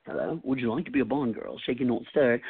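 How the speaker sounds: a light Scottish Sean Connery voice. Shown as a demonstration. Guest does a lightly voiced Scottish Connery impression. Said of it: "Or Sean Connery".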